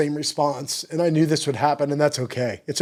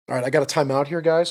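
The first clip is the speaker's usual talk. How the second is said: normal talking voice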